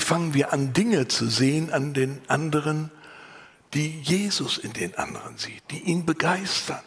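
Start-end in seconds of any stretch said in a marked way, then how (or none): none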